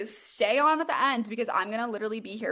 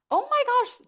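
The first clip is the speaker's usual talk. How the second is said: squealed oh my gosh, much higher; surprised; 13 semitones higher, 5 dB louder than usual. Real, not acted. Host seeing chat suddenly full, surprised squeal. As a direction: caught off guard with delight.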